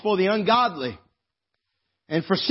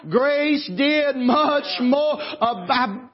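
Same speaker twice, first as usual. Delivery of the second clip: raised, ringing preacher's voice with catches of feeling; preaching at full force; 6 semitones higher than usual. Acted. Preacher pleading with the congregation to be saved, fervent full-force preaching. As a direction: preach it out, pleading, rising to a cry. Preaching build-up to altar call.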